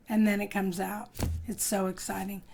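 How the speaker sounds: usual speaking voice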